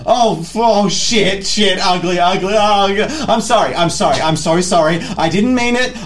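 loud, high, frantic begging; panicked pleading. Acted. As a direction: beg for your life, voice up high. Terrified Vinod begs Ugly.

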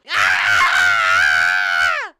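loud, long acted scream; tantrum scream. Lube throwing a temper tantrum, screaming. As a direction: hold one loud screaming "ah". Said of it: "just starts screaming".

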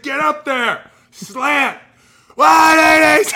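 high, loud called orders; barking coach. Retold or imitated. Coach calling out the route to the receiver, loud and high. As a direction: call the route like a drill sergeant. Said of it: "He's like".

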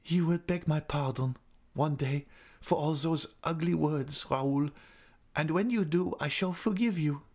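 high-pitched woman's voice, restrained reproach; high, gently reproachful woman; wounded heroine. A narrator's reading. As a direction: answer his insults in a high, dignified voice. Insulted woman answers the angry man.

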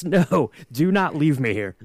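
ordinary talk

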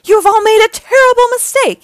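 high, very loud menace; angry villain threat. A narrator's reading. Dreg after losing his Robugs, angry threat. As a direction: threaten them loudly, pitched high. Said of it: "this only angered Dreg".

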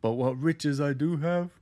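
lightly voiced humble caretaker; earnest, simple caretaker voice. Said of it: "he plays the simple caretaker".

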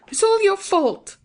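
put-on old aunt bursting out at the nephew; cried out. A narrator's reading. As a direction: throw the blame at him. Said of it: "I cried".

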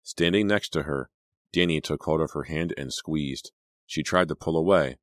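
ordinary delivery, nothing marked

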